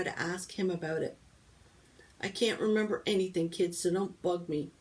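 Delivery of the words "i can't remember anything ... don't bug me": ordinary delivery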